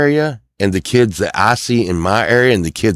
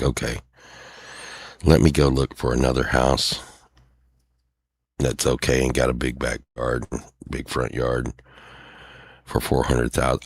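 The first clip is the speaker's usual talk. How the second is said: sarcastic mutter; 8 semitones lower, 8 dB quieter than usual. Retold or imitated. Own sarcastic reply, much lower, softer.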